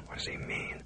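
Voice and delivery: voiceless breathy whisper, barely there; terrified whisper. Acted. Lydia whispering to herself, alone with captor: terrified whisper. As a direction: hush it like a thought you dare not say aloud.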